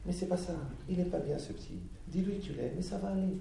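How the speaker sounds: put-on high soft old grandma voice; grandmother.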